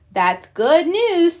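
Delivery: put-on child voice. A narrator's reading. Brother cub voiced.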